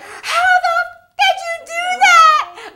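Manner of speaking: very high, slow laughing shriek; shocked shout. Retold or imitated. To the interviewer after seeing the papers, shrieking laughter. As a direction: scream it out in laughing disbelief.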